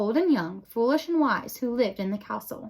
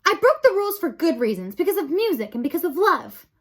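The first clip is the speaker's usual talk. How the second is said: higher, louder defiant plea; raised voice; 7 semitones higher, 5 dB louder than usual. A narrator's reading. Despero defending himself to the council, raising his voice. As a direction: stand up for yourself out loud. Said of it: "He raised his voice".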